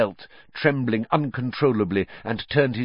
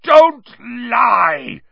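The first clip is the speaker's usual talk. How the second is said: high, loud, furious shout; explosive roar; bellowing; 10 dB louder than usual. A narrator's reading. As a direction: bellow it in rage.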